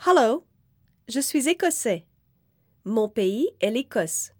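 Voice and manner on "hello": normal talking voice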